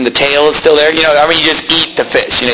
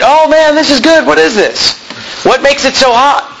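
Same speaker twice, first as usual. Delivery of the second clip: delighted eater; 9 semitones higher than usual. Retold or imitated. Teenager gushing to his host about spicy food, high and thrilled. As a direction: gush over the hot food. Seth voiced enjoying the pepper.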